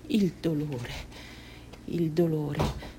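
low, soft and slow; hushed suffering. Acted. Mutilated girl remembering the pain, hushed suffering. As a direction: let the word sink under the pain.

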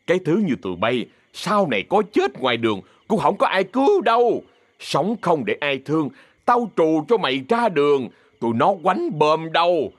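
scolding that climbs high on key words; angry cursing. Acted. Má Bảy throws mother and daughter out, angry cursing. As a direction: drive them out with a last high order. Acted quarrel, cursing and ordering them out.

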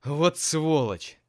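loud, high, slow curse; furious shout. A narrator's reading. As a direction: spit it out in fury. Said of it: "заорал Сеня".

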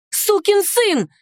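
high, drawn-out enraged insult; incensed cry at ordinary volume; furious cry. A narrator's reading. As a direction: hurl the curse in outrage, pitch way up. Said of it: "закричала я вне себя от ярости".

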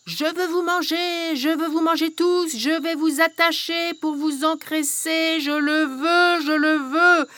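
mildly yelled greedy rant; furious witch. Acted. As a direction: she hurls it after them. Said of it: "Elle hurlait".

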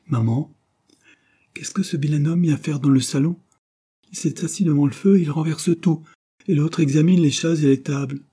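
put-on high, loud child's voice, blurting; a worked-up little boy tattling; impetuous boy. A narrator's reading. Frank telling his mother about the bailiff, a loud childish outburst. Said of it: "l'impétueux Frank qui parlait".

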